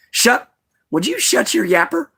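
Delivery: high-pitched playful telling-off; high scolding voice. Real, not acted. Scolding his barking dog.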